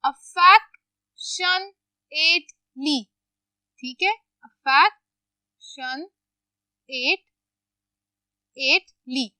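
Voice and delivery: affectionately stretched out very slowly, syllable by syllable; slow dictation. Shown as a demonstration. Teacher to students, dictating affectionately very slowly. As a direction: spell it out slowly so the class can write it. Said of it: "okay, affectionately".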